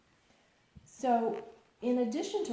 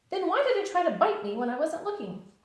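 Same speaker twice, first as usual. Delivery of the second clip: very high, loud bouncy hello; squeaky bright Tigger greeting; 10 semitones higher, 9 dB louder than usual. Acted. Tigger answering Pooh's hello outside the door.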